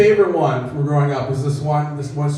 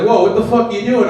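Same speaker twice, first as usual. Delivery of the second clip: startled customer; 6 semitones higher, 4 dB louder than usual. Retold or imitated. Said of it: "he's like".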